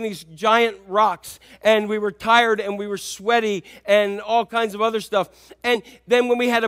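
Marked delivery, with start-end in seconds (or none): none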